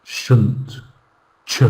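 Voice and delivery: "Shunt" and "ch" as reduced, isolated syllables; teacher modelling unstressed "Shunt", "ch"; weak syllables alone. Shown as a demonstration. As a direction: softly clip out "Shunt" and "ch" with schwa. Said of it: "weak sound of English".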